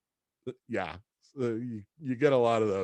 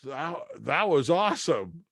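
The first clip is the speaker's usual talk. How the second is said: thrilled; 5 semitones higher than usual. Retold or imitated. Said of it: "I was like".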